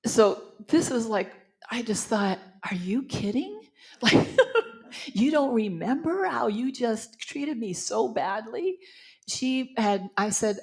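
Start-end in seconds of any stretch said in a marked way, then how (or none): 2.7-8.8 laughing in disbelief, a bit slower; incredulous laughing. Retold or imitated. To herself at the reception, laughing in disbelief.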